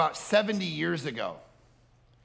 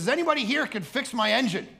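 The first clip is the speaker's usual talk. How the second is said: calling out; 11 semitones higher, 7 dB louder, faster than usual. Retold or imitated. Grandfather calling at gas station.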